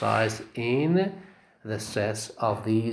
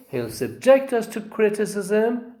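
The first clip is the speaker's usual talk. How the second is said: "subject" as a verb, stress on -JECT; stress on second syllable; 8 semitones higher than usual. Shown as a demonstration. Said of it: "the stress is on the second syllable".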